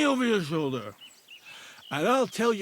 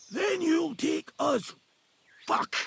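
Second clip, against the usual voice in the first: ordinary delivery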